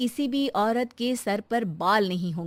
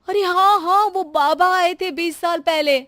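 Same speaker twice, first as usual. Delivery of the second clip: much higher, loud excited burst; sudden recollection; 8 semitones higher, 8 dB louder than usual. Acted. Shanti suddenly remembering the baba, loud excited burst. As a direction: blurt it out as it dawns on her.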